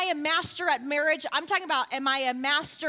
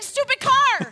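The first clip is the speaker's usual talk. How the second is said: louder, higher mocking of the car; mock grumble at a cheap car; loud mock complaint; 9 semitones higher, 7 dB louder, slower than usual. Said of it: "You keep saying".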